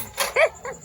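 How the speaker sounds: short high-pitched giggle. Real, not acted. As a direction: let out a soft, high giggle. Child laughs after the exchange. (हल्की ऊँची खिलखिलाहट)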